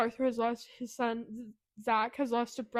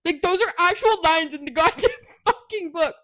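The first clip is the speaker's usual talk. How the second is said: loud, high laughing outrage; real laughter spilling over; laughing at how brutal the book is; laughing in disbelief; 6 semitones higher, 11 dB louder than usual. Real, not acted. Disbelief at a book's line.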